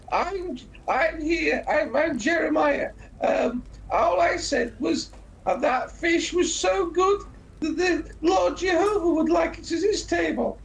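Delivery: high, pleading comic voice, \"pillocks\" style Brian scene; retold film character. Retelling Life of Brian, pleading the stoned man's innocence in a high voice. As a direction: squeak out an innocent protest. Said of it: "He really takes it".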